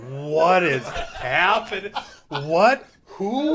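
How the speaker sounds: loud, high, laughing confusion; confused laughing. Retold or imitated. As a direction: laugh through baffled questions. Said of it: "My brain just goes".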